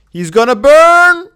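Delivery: alarmed, slow and loud; panicked shout. Real, not acted. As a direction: cry out in alarm, drawn out.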